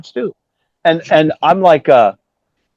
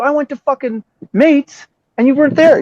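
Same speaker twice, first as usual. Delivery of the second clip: retold annoyance; 11 semitones higher, 4 dB louder than usual. Bassist telling Piercy he went to Mates, exasperated. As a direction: spell out the grievance in mock indignation. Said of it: "I'm like, "I'm pissed".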